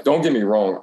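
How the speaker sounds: normal talking voice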